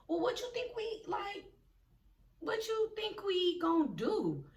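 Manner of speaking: high, small, whiny voice; helpless asking. Retold or imitated. Turns to the friend after being left, helpless asking. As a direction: imitate a timid, pleading question.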